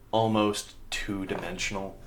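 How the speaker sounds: normal talking voice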